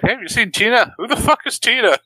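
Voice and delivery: high mock voice; goofy high quote; mock Chris. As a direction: Chris asking about Tina. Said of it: "He's like".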